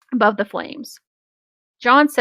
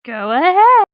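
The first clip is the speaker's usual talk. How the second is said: mock echo; 9 semitones higher, 6 dB louder than usual. Friend echoes line higher, louder.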